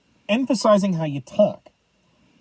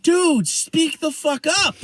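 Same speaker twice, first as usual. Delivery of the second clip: exasperated yell; 7 semitones higher, slower than usual. Retold or imitated. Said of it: "I'm like".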